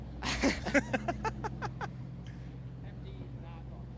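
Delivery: airy wheezing chuckle; breathy laugh. Real, not acted. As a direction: laugh softly through breath. Laughs at own size joke.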